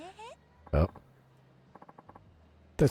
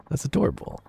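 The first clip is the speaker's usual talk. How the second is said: ordinary delivery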